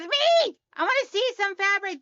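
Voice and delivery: high, loud eager squeal; excited viewer. Retold or imitated. Reading chat from Laura, an excited fan. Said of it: "Laura goes".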